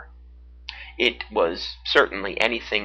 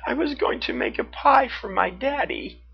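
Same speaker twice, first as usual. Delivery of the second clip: put-on sobbing girl; 13 semitones higher than usual. A narrator's reading. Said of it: "she sobbed".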